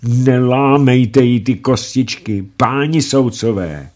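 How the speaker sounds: high, loud and slow pleading cry; anguished cry. Acted. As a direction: cry out in pain and anger to the judges. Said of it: "třásl bolestí a hněvem a volal".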